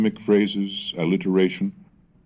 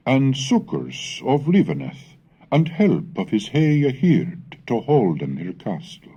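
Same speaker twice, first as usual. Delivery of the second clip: put-on measured reading of an old text, in Middle English pronunciation; 4 semitones higher than usual. Reads Middle English prose excerpt.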